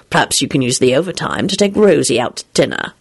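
low, smooth suggestion; lofty character. A narrator's reading. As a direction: suggest it with a hint of superiority. Lofty character replies in argument.

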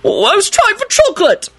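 very high, wailing excuse; frantic howl. Acted. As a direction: wail the excuse in panic. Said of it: "Arthur howled frantically".

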